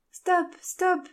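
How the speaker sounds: high, urgent shout to halt; crying out. A narrator's reading. Jane cries out to stop the porters, urgent shout. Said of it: "s'écria-t-elle".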